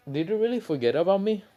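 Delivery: high, quick disbelieving question; acted disbelief. Naruto to friends who don't recognize him, acted disbelief. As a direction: ask it high and quick, incredulous.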